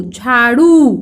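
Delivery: झाड़ू with both vowels held long; pronunciation demo. Shown as a demonstration. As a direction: sound out झाड़ू for pupils. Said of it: "उच्चारण हो गया".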